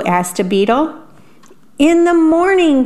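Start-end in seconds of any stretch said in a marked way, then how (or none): 1.8-2.9 high, bright group answer; cheerful cry. A narrator's reading. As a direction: call it out happily like a chorus of beetles. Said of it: "cried all the other beetles".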